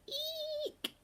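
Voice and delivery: very high, thin squeaky screech; a baby owl trying his first grown-up cry. A narrator's reading. Baby owl tries out his voice, a tiny squeaky screech. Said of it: "he screeched".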